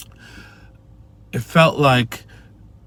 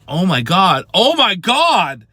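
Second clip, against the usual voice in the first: louder and higher, stretched out in awe; excited; 7 semitones higher, 4 dB louder, slower than usual. Retold or imitated. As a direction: gush it like a fan seeing his hero return. Retelling the Luke reveal in bath.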